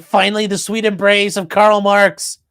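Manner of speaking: slowed, dreamy mock reverence; sarcastic dying activist's ecstasy; mock dying rapture. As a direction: sigh it like a blissful martyr. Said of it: "he's like".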